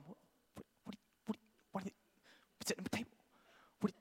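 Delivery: barely voiced, halting whisper; mime-whisper urgently to a friend; broken, near-silent covert questioning; mock secret whisper. Imitating girlfriends' covert signalling across table.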